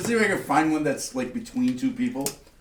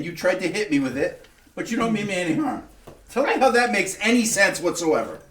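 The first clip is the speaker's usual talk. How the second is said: indignant; 8 semitones higher, 4 dB louder than usual. Acted. Outraged at the stranger.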